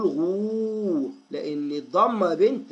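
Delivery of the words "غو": غو held long as a steady oo; slow teaching demo of a long غو; lengthened damma. Shown as a demonstration. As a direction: stretch the vowel of غو right out. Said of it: "هنطول في الضمة".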